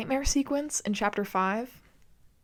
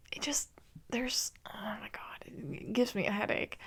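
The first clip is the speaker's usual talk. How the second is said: whispered, worn-out groan of disbelief; whispered exasperation; exasperated whisper; 9 dB quieter than usual. Real, not acted. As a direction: drop to a whisper like it hurts your head. Exasperated aside drops to whisper.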